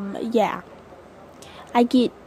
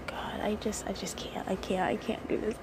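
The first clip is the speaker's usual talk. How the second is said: much softer, whispered, giving up; overwhelmed whisper; 3 semitones lower, 12 dB quieter than usual. Real, not acted. To viewers, too nervous to continue, a broken whisper. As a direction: barely breathe the words out.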